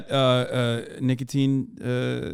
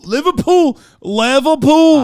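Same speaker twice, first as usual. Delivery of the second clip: high, loud, stretched Scouse "Liverpool"; Scouse accent; 10 semitones higher, 9 dB louder, slower than usual. Retold or imitated. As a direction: belt out "Liverpool" like a hammed-up Scouser. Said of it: "I was thinking Liverpool".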